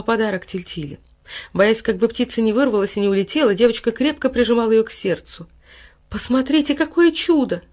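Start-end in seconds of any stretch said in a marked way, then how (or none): 6.1-7.6 high, smiling exclamation; happy amazement, voice lifted; joyful. A narrator's reading. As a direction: show off a wonder with delight. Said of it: "радостно улыбаясь".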